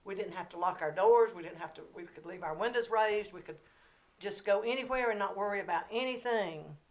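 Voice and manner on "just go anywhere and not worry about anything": normal talking voice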